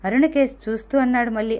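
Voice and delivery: usual speaking voice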